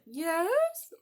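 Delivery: high, loud ant answering the door; curious ant. Acted. Ant answering a knock, high and loud. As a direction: call out from behind the door. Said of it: "And the ant said".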